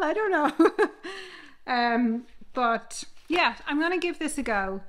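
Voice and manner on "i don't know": laughing at own joke. Real, not acted. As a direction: giggle it out. Joke about hotel, laughing.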